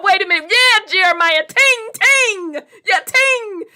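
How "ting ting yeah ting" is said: lightly voiced Jamaican patois, higher; playful Jamaican accent imitation; a touch of Jamaican lilt; mock Jamaican. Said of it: "Jamaican sounding".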